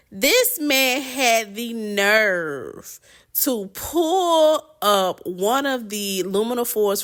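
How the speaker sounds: slow, drawn-out indignation; scandalized, slow-building complaint; indignant disbelief. Real, not acted. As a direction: stretch the words out, scandalized. Indignant telling of stranger's nerve.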